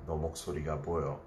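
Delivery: ordinary talk, nothing marked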